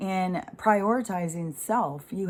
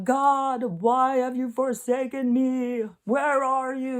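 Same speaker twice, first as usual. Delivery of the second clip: high, drawn-out cry to God; plaintive, higher-pitched mock lament; forsaken prophet; 6 semitones higher, 3 dB louder, slower than usual. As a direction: act out a prophet pleading to heaven. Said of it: "they're throwing their hands up".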